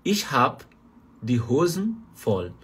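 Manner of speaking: slow, careful phrase, drawn-out end; slow German demo. Shown as a demonstration. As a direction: spell the sentence out slowly for learners. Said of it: "a frase continua correta".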